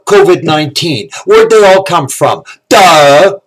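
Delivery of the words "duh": loud mocking "Duh!"; scornful duh. Real, not acted. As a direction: bark it like it's obvious. Scornful obvious answer.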